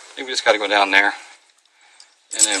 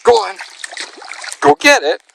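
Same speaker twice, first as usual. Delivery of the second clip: much higher, louder coaxing; high dog urging; 3 dB louder than usual. Real, not acted. Owner calling his dog to fetch the stick, high and loud.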